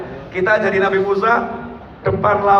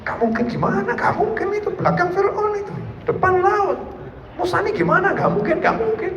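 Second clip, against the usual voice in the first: high, soft, incredulous whine; whining disbelief; 10 semitones higher, 5 dB quieter than usual. Acted. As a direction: fret in shrill disbelief. Followers' panicked disbelief. (umat ragu dan panik dengan suara tinggi lirih)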